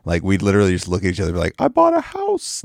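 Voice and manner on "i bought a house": very high, slow imitation wail; drawn-out high running-joke voice; mock distraught catchphrase. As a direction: squeal it as a hammed-up lament. Repeating the favorite running-joke quote.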